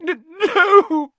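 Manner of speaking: very high, loud, stammered wail; hammed-up, stuttering cry; sobbing. A narrator's reading. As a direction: sob the word out. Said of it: "sobbed Lavender".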